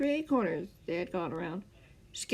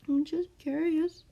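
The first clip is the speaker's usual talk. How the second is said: higher, slow playful tease; teasing; 10 semitones higher, slower than usual. A narrator's reading. Scar teasing his captor, higher and playful. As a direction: tease him lightly. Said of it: "Scar teased with a chuckle".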